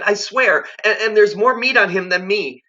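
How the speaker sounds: put-on very high, panicky boy's voice; high scared child bargaining; frightened boy. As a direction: stammer it out like a scared kid.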